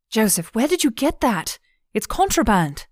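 high-pitched startled questioning; alarmed shock. Acted. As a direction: demand an answer in shock. Shocked at seeing forbidden candle.

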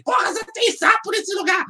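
high-pitched complaining wife voice. Retold or imitated. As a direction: whine it the way the wife did. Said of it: "minha mulher reclamando todos os dias". (esposa reclamando com voz aguda)

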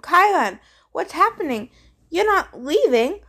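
put-on a girl fighting back worry; shaky voice. A narrator's reading. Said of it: "Her voice was shaky".

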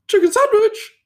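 loud mock falsetto; really high-pitched voice. Coworker asking busy chef for food, high-pitched voice. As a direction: squeak it out very high, mimicking the coworker. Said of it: "he just had this really high-pitched voice".

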